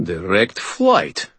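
slow, impressed man's voice; pleasantly surprised; surprised approval. Acted. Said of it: "with both surprise and approval".

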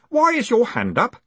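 put-on high, innocent boyish question; cheerful. A narrator's reading. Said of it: "said Stanley cheerfully".

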